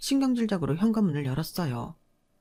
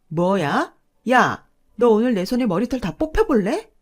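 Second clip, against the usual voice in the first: angry threat; 2 semitones higher, 5 dB louder than usual. Acted. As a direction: threaten to pull her hair out, shouting. Sister-in-law threatens a fight.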